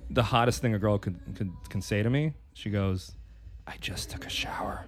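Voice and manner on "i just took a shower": soft breathy whisper; retold girlfriend's whisper. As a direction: whisper it close in his ear. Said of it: "she whispers".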